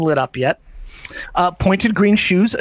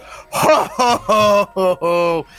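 loud deep Santa laugh; much slower than usual. A narrator's reading. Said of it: "bellows out with a very, very loud and deep".

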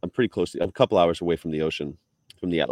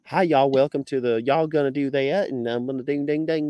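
higher-pitched mock Southern twang; Virginia accent; 6 semitones higher than usual. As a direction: imitate Virginia locals, sliding into Southern gibberish. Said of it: "the Virginia accent is".

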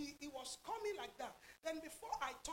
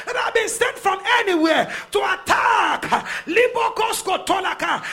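loud, shouted preaching with drawn-out key words; full-force preaching; 5 semitones higher, 28 dB louder, slower than usual. Real, not acted. Preacher commanding the congregation and dark forces, full-force preaching.

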